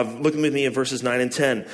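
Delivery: ordinary delivery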